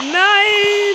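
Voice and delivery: shocked cry. Real, not acted. Enemy kills him suddenly.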